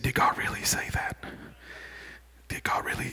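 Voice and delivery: hushed, unsettling whisper of doubt; barely voiced tempter's whisper; devil's insinuating whisper.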